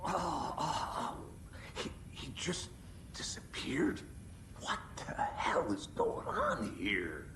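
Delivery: gasping, half-whispered shock; a hushed, shaken whisper after the vanishing; stunned disbelief. Acted. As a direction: breathe it out, shaken and lost.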